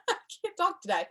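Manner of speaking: laughing at herself. Real, not acted. Trainer laughing at her own tongue-tied slip, amused and high-pitched. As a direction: giggle at your own slip.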